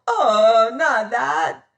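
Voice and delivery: high, loud terrified wail. Acted. Boatswain waking and begging the captain, a terrified wail. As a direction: wail it in panic. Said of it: "he wailed".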